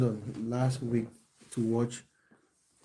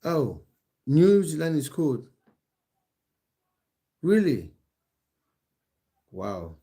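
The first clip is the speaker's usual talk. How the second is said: higher and louder, genuinely caught off guard; surprised; 5 semitones higher, 7 dB louder than usual. Real, not acted. Streamer reacting to a viewer saying New Zealand is cold, surprised. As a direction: jump up in surprise at the news.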